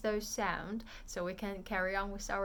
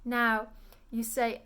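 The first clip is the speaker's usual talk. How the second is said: ordinary delivery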